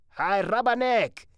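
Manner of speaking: loud, high mocking call; teasing thug. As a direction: jeer at her like a street tough. Township thug teasing a girl.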